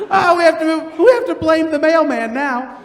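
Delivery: loud and high through laughter; laughing. Retold or imitated. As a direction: speak while cracking up. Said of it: "she breaks into laughter going".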